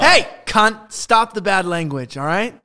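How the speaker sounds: sudden loud bark at a guest; host loudly shuts down a guest's swearing; loud scolding. Real, not acted. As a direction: call him out loudly to stop swearing.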